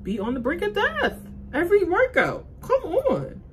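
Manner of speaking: high and loud, exasperated; loud, high disbelief at the workout; worked up. Real, not acted. Reliving breathless workout.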